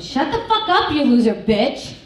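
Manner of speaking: high, loud snapped yell at her daughter; mock yell. Mom yelling at whispering daughter in waiting room, loud mock fury. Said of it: "I yelled".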